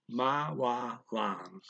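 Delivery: "ma wa lang" split into slow separate syllables. Shown as a demonstration. Teacher spelling the phrase out for learners, slowly split syllables. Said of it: "Okay, so M W".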